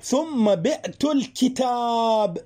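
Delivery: ثم بعت الكتاب read very slowly, word by word; slow teacher demo; slow example. Shown as a demonstration. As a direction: spell the example out slowly for students. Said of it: "تستطيع أن تقول".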